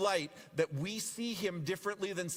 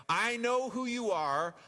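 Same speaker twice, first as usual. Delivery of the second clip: slow, louder demon voice; voicing a frightened demon, drawn out; mock demon cry; 6 semitones higher, 4 dB louder than usual. Demons quoted confronting Jesus.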